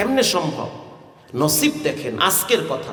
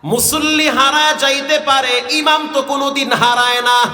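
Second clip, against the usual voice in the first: imitated mother's cry; 8 semitones higher, 4 dB louder than usual. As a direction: wail it out as the mother's loud, melodic plea. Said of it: "আমার মা চিৎকার মেরে বলতে আছে". (মায়ের আর্তচিৎকারের অনুকরণে টানা সুরে)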